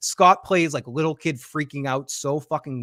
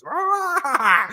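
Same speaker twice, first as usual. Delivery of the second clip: high, held-back mock scream; long roar that spares the voice; imitated scream; 12 semitones higher than usual. Host imitating [name]'s scream, high restrained mock roar. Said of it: "I will kill my voice".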